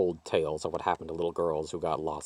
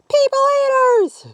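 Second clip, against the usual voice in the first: put-on very high, loud slow child's shout; piercing high child's yell; little girl shouting; 14 dB louder, slower than usual. A narrator's reading. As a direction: yell it in panic as she runs. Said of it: "she shouted".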